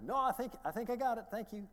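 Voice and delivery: high, loud imitation of the stranger declining; stranger's voice.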